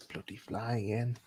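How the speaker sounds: ordinary talk, nothing marked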